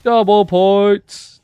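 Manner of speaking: slow booming announcer imitation. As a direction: drawl it like the game announcer. Mimicking the game announcer.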